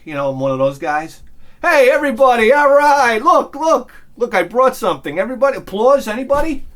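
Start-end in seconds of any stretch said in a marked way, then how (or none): 1.6-6.6 loud, high holler to the whole room; show-off party entrance. Acted. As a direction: announce yourself loudly, fishing for applause. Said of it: "I gotta make sure everybody can see".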